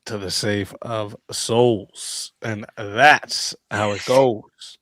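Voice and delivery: slow dramatic show sign-off; announcer voice. Acted. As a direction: boom it out like a fight announcer. Host's show closing line.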